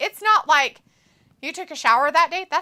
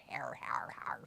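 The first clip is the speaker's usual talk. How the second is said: whisper; 12 semitones lower, 18 dB quieter than usual. Pretend. Mocking the accent.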